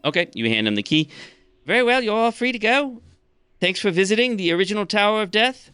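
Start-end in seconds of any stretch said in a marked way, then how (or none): 1.6-5.7 high skeleton gatekeeper's send-off; Pink the skeleton. Acted. Skeleton sees visitors off from the tower, high character voice. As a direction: dismiss them in a raised character voice. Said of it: "Pink the skeleton".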